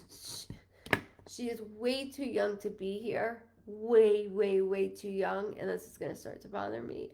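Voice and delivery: usual speaking voice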